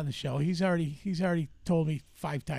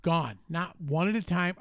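ordinary delivery

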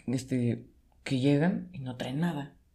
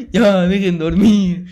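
put-on old neighbor yelling; 5 semitones higher, 12 dB louder, much slower than usual. Neighbor yelling at noisy kids at night: slow loud shout. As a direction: boom it out like a fed-up neighbor at midnight.

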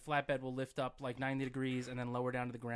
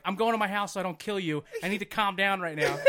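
retold warning; 4 semitones higher, 7 dB louder than usual. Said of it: "something along the lines of".

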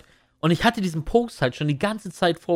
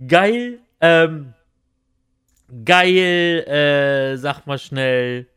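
trailing off; 8 dB louder, much slower than usual. Real, not acted. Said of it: "Jetzt hab ich's vergessen".

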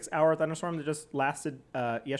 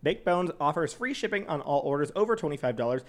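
even, scripted sponsor read. Real, not acted. Co-host reads dog CBD sponsor copy, announcer-like. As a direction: read the copy clearly and steadily.

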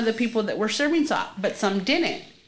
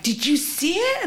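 retold excited question; 10 semitones higher than usual. Retold sisters running out excited.

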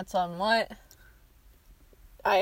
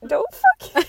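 ordinary talk, nothing marked

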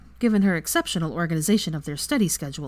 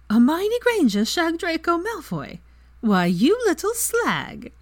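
high-pitched, scandalised amazement; amazed; 11 semitones higher, 4 dB louder, slower than usual. A narrator's reading. Friend learning of the affair, amazed. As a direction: exclaim it in gleeful shock, pitch soaring. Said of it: "gasped and then said in amazement".

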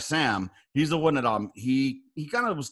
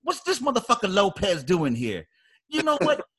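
raised-pitch mock accusation; imitating first sergeant; 5 semitones higher than usual. First sergeant baiting Lopez to break his bearing, mock indignant. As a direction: needle him in a higher, put-on sergeant voice. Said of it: "'Cause that's how he talks".

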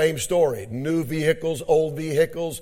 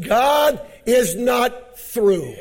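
climactic preaching; 8 semitones higher, 4 dB louder, much slower than usual. Acted. Preacher declaring God is not through, climactic preaching. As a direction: build to a high, stretched pulpit shout.

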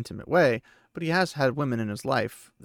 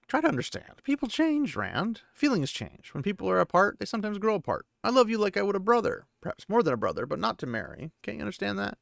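higher, gentle-voiced young woman; Egwene letting him down; 8 semitones higher than usual. Egwene telling Rand she won't marry him, higher gentle voice. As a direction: pitch up and ease the blow.

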